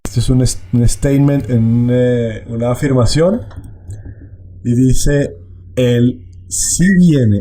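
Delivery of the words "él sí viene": "sí" drawn out, slow and stressed. Shown as a demonstration. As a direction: lean on "sí" to affirm. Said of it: "Este sí, sí, él sí viene". (acento en "sí", lento y marcado)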